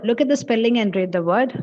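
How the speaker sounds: usual speaking voice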